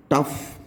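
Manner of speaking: टफ with final GH pronounced as F. Shown as a demonstration. Teacher giving learners a last GH example, F sound. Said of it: "ये फा की sound देता है".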